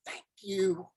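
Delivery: silently mouthing thanks from a passing truck; mouthed thanks. Retold or imitated. Old garbage man mouthing thanks from the truck, barely voiced. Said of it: "he mouths the words".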